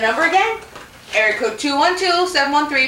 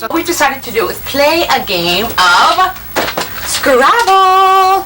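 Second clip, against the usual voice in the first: loud presenter; 3 semitones higher, 5 dB louder than usual. Acted. Loud kids' cooking show.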